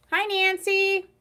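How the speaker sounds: bright high hello to a friend; cheery greeting. Real, not acted. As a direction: sing out a warm hello. Greeting a viewer.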